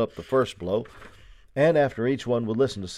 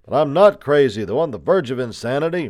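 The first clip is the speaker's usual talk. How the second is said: loud, high insistence; shouted protest; 5 semitones higher, 8 dB louder than usual. A narrator's reading. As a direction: shout back that they are mistaken. Prisoner protests through the shutter.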